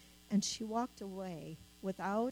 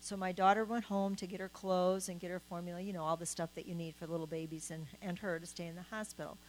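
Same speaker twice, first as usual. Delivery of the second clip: ordinary talk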